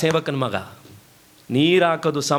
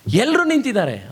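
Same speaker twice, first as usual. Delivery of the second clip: higher, animated acted remark; raised-pitch onlooker's protest; urging onlooker; 7 semitones higher than usual. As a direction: point it out insistently to someone weeping. Onlooker challenges weeping violinist.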